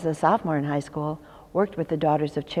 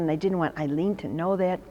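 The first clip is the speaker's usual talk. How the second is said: normal talking voice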